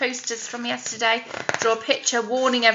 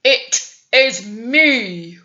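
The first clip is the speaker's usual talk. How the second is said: grunting goat; 7 dB louder, much slower than usual. Acted. Biggest billy goat answering the troll, slow loud grunt. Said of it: "grunted the biggest billy goat Gruff".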